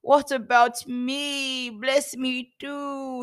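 anguished wail, high and drawn out; loud bitter cry. Acted. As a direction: cry out loudly, stretching each plea. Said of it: "a loud and bitter cry".

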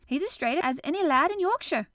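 high, loud proclamation; cried out. A narrator's reading. Friend defends the standing boy, crying out. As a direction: declare it excitedly for all to hear. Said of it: "cried Dickon".

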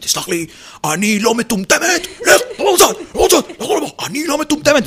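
high, loud, exasperated teacher voice; a shrill-free high mock teacher scolding the class; impression of a teacher. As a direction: play the fed-up teacher telling a boy off. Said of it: "הייתה תמיד נכנסת לכיתה ואומרת".

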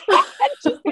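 words through a high laugh. Real, not acted. Laughing at her own panic.

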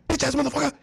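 very high hollered rap ad lib; imitated ad-lib yell. Rapper runs into the booth and yells, high imitated holler. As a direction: burst out with it into the mic.

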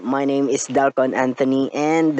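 ordinary delivery

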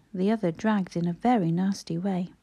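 ordinary delivery, nothing marked

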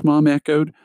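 ordinary delivery, nothing marked

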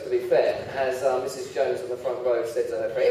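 ordinary talk

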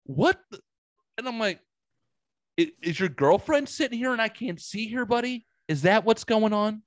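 high, mocking sarcasm to a silent stranger; sarcastic mockery. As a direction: tease him about an invisible girlfriend. Mocking the silent stranger in his retelling.

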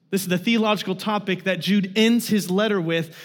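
ordinary talk, nothing marked

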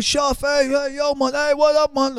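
high, softened distant hail; high-pitched far-away greeting; calling from afar. Retold or imitated. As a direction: imitate someone calling down from a balcony. Man calling down from balcony.

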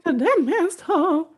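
high, slow witch voice; witchy drawl. Retold or imitated. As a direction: savor it like a cackling witch. Quoting witch recipe line.

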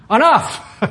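"Enough!" burst out high and loud with a laugh; laughing exclamation. Shown as a demonstration. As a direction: shout it laughing, playing on the word. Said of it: "Doesn't it sound like enough?".

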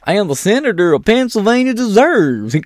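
mock him in a shrill parody; mocking Fetterman voice.

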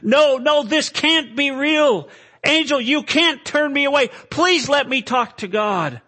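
high, loud cry of refusal; desperate pleading. Acted. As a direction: beg at the top of the voice. Acted sinner begs the angel.

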